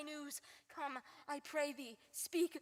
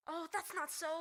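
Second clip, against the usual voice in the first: ordinary delivery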